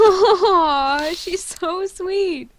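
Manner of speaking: slow, high laughing coo. Acted. Cooing laughing.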